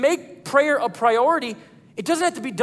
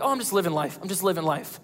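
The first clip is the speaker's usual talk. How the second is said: casual shrug voice; 4 semitones lower, 6 dB quieter, faster than usual. Retold or imitated. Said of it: "you're like".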